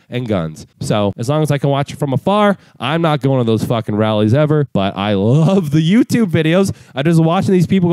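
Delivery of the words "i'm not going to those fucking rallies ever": normal talking voice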